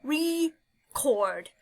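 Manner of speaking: Record said as re then cord with a small break; syllable split. Shown as a demonstration. Teacher breaking Record into syllables for students. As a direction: sound out Record in two parts. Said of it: "I'm gonna break it right there".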